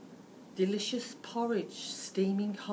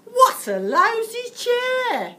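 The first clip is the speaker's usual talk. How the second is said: slow, much higher and louder, rude bellow; 14 dB louder, slower than usual. Acted. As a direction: Goldilocks bellows at the broken chair. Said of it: "She bellows".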